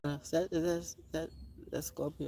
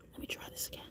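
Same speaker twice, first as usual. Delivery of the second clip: whispered; 16 dB quieter, much faster than usual. Real, not acted. Whispered repeat.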